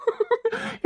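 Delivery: a short high giggle at her; high teasing laugh. Acted. Husband laughs at his crying wife, high giggle. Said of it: "நக்கல் அடிச்சிட்டு இருக்கீங்களா".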